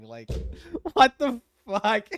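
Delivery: usual speaking voice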